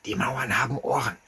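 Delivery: conspiratorial whisper. A narrator's reading. Masked friend warns narrator in stairwell: conspiratorial whisper. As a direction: hush it like the walls are listening.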